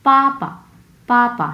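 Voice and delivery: পাপা high and loud first, second syllable released at once; fourth tone, then neutral. Shown as a demonstration. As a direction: hit the first পা high, drop the second short. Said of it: "so ধরেই ছেড়ে দিব".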